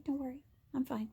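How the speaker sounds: fading to a whisper, still brushing it off; whispered reassurance. Retold or imitated. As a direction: hush it away, pretending all is fine. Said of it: "blood squirting out of me".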